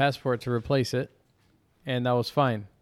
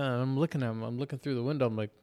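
ordinary talk

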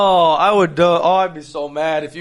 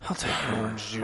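sheepish mumble; 3 semitones lower, 12 dB quieter, faster than usual. Retold or imitated. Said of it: "And I was like".

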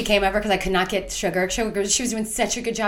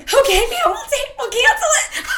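high, laughing meek reply; high laughing meek giving in; meek laughing surrender; 3 dB louder than usual. Retold or imitated. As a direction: fold meekly while laughing. Owner cowed into cancelling.

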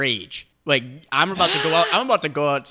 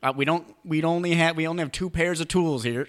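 put-on Southern doctor accent; 5 semitones higher than usual. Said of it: "that had a thick Southern accent?".

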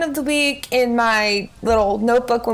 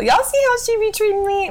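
mock indignation; 8 semitones higher than usual. Mock complaint to audience.

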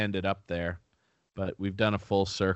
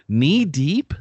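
puzzled; 4 semitones higher, 7 dB louder than usual. Real, not acted. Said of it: "That doesn't make any sense".

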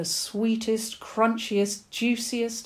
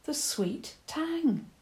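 slow, high wondering echo; 10 semitones higher, much slower than usual. Acted. Eva echoing the badger's words, high, slow and wondering. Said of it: "Eva said".

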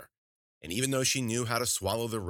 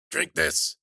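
loud, high witch's order; high, forceful old-crone voice; bossy witch; 10 semitones higher, 6 dB louder than usual. Acted. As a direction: command the patient in a witch voice. Witch orders patient to drink.

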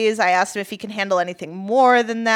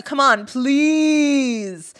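mock pleading; 4 semitones higher, much slower than usual. Reading his pleading text aloud.

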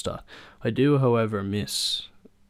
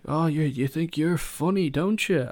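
higher, louder mock menace; menacing stranger; 7 semitones higher, 4 dB louder than usual. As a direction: threaten them in an imitated sneer. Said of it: "I was like".

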